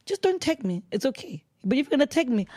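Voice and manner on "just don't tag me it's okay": usual speaking voice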